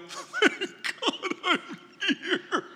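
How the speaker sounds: high, slow, laughing sigh of relief. Pretend. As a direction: breathe it out with a laugh, arrived at last. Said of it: "you're gonna go".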